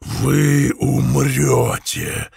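slow, drawn-out hoarse threat; booming hoarse voice. Acted. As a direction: roll it out like distant thunder. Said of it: "хриплый и низкий голос, громом прозвучавший".

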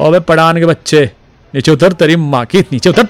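high, loud screaming abuse; furious shouting. A narrator's reading. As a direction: scream insults at the Pathan above. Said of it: "चीख-चीख कर गालियां बकने".

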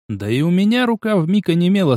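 usual speaking voice

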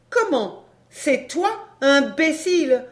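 high-pitched, mocking surprise; scornful woman. A narrator's reading. Woman at window recognising her old partner, scornful. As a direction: toss the insult down, pitched high. Woman mocks the man below.

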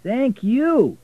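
loud, high cabbie thanks; cab driver. A narrator's reading. Driver thanking Richard for five dollars, loud and high. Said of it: "said the driver".

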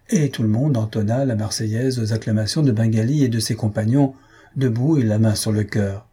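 usual speaking voice

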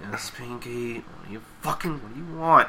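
exasperated, very quiet groan; Twilight. Retold or imitated. Twilight answering eager Pinky, softly exasperated. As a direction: let it out under your breath, worn down. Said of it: "looking so exasperated".

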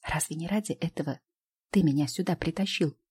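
plain reading voice

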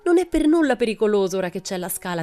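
high, loud, thrilled urging; excited calling. A narrator's reading. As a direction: coax a friend eagerly at full voice. Boy calls excitedly from inside tree.

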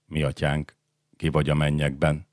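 soft, subdued whimper; whimpered prayer. A narrator's reading. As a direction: pray feebly under your breath, terrified. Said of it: "Vinnyogta Radul".

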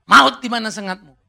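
loud ringing call at the congregation; preached shout. Acted. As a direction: proclaim it to the church. Preaching climax. (seruan keras dari mimbar)

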